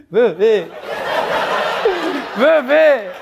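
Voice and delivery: high, loud mock wail to a missed loved one; exaggerated emotional reunion cry; tearful calling. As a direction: call out in a hammed-up, high-pitched whine. Tearful reunion call acted out.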